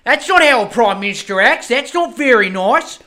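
high, loud, indignant pundit parody; mocking Sky News pundit. Mocking Sky News scolding Albo, loud whiny outrage. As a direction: whine like an outraged TV commentator. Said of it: "was like".

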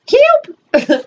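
high, thin mewling cry of help; cat-like cry for help. Retold or imitated. Imitating the fallen neighbor, a high mewling help. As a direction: wail it like a yowling cat. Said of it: "my neighbor sounded like a cat".

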